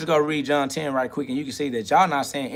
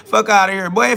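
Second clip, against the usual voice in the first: said again, much louder and punchier; louder repeat; 4 semitones higher, 7 dB louder than usual. Shown as a demonstration. Dismissing the people who call him a sinner, louder. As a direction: hit it harder the second time.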